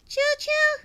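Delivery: high choo choo like a toy train; playful train whistle; train noise. Pretend. Hype train announced.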